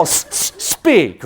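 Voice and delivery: "s-, s-, speak" with the opening sound repeated and split off; mock phonics sounding-out. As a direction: hiss the first "s" separately before "speak". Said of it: "that's the way we all".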